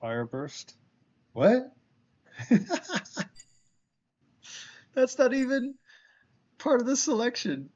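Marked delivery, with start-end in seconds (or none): 1.3-1.7 sharp, very high, loud what; real shocked squeak; incredulous what. Real, not acted. Baffled by an odd game option. 2.4-3.4 squealing incredulous giggle; real surprised laugh; high disbelieving laugh. Real, not acted. Laughs at odd option. 4.9-7.7 high and loud, laughing in disbelief; real amused disbelief; incredulous. Real, not acted. Baffled by an odd game option.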